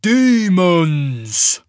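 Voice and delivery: loud, drawn-out voice like an avalanche; rumbling giant. A narrator's reading. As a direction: boom it out as a huge giant. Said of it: "the giant rumbled".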